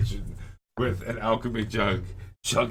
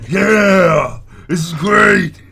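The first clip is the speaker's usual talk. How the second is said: high, long-held triumphant shout; wild enthusiasm; 12 semitones higher, 16 dB louder, much slower than usual. Acted. Drinker with glass in his head, enthusiastic shouting. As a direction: roar with reckless delight. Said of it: "I love your enthusiasm there".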